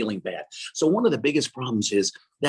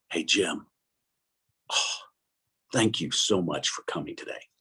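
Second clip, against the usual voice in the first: gentle greeting; 8 semitones lower, 4 dB quieter than usual. Retold or imitated. God greeting the man in prayer.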